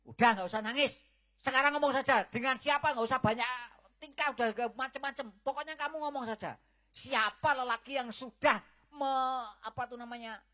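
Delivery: angry scolding. Acted. Father scolds his crying daughter, loud and angry. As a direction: berate her, impatient for the name.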